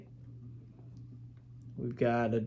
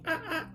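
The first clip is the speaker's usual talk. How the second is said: light, high monkey squeak; monkey noises; 6 dB quieter than usual. Acted. Parent pointing out George in the book, monkey noises. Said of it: "He goes".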